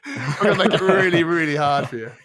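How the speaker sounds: loud, high, through real laughter; laughing tease. Real, not acted. Quizmaster threatening a hard quiz to the guesser, laughing loudly. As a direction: tease gleefully while cracking up. Teasing co-host while both laugh.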